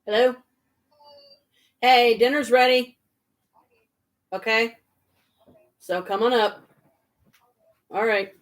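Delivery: loud call to another room; yelling across the room. Real, not acted. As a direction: call out so they hear you. Calling out that dinner's ready.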